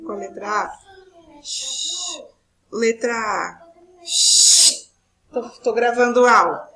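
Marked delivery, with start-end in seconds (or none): 1.4-2.2 long hushing "shhh"; drawn-out hiss for silence; shushing. Real, not acted. Hushing noise during recorded lesson. 4.0-4.9 loud, forceful "shhh"; loud hiss demanding silence; sharp shushing. Real, not acted. Second hush at background noise. 5.3-6.7 raised voice at someone interrupting; loud, real scolding aside; loud telling-off. Real, not acted. Tells someone off mid-recording.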